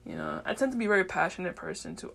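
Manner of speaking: ordinary talk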